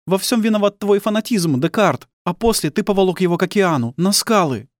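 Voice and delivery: high, vehement woman's tirade; accusing wife. Acted. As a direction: open with a loud cry, keep accusing in a high voice.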